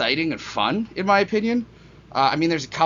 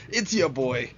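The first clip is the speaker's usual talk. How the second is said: hype intro; 3 semitones lower, 3 dB quieter, slower than usual. Pretend. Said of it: "Oh no, I'm doing way too much".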